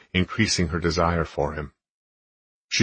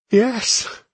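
high loud ecstatic cry; 6 dB louder than usual. A narrator's reading. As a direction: release it as a cry. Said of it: "she released with a cry".

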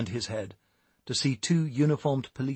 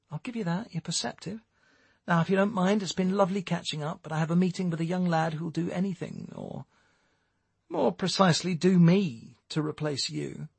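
higher-pitched, breezily cutting dismissal; taunting Jerry; 6 semitones higher than usual. A narrator's reading. Jerry dismisses Stewart for his replacement, taunting. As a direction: dismiss him with mock courtesy, voice lifted.

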